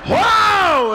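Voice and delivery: huge high drawn-out "whoa"; wife's labor yell. Retold or imitated. Husband retells his wife's first labor pain, huge high yell. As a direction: howl it out at full volume.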